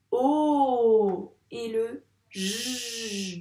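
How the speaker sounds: O very long and loud, J a long "zh"; sounded-out letters. Shown as a demonstration. Teacher sounding out the last syllable, O and J drawn out. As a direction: stretch O right out, then hold J. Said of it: "Cette lettre, elle fait quel son".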